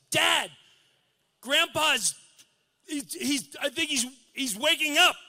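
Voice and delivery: put-on high, excited young girl's voice; playing his daughter calling him down. Daughter calling dad that grandpa is waking, high and urgent.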